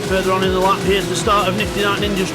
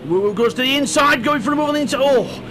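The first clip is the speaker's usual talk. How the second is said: rising, quicker play-by-play; excited commentary; 5 semitones higher than usual. Real, not acted. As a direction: lift the voice as the move happens. Overtake attempt in live race.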